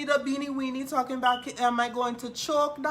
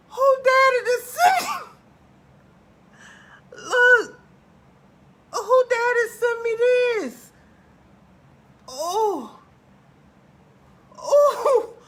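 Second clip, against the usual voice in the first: crying laughing; 10 semitones higher, 10 dB louder, slower than usual. Real, not acted. Shocked by picture, laugh-crying.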